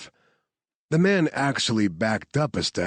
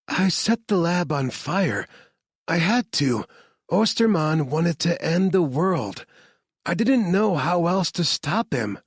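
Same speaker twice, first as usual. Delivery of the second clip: higher woman's voice, earnest and pressing; Claire urgent; 10 semitones higher than usual. Acted. Scientist explaining to soldiers why she burned the lab, higher and urgent. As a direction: justify what she had to do.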